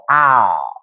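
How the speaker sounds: "Ow" as a long drawled AW; drawled vowel. Shown as a demonstration. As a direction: stretch "Ow" low and lazy. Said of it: "It's more of a draw".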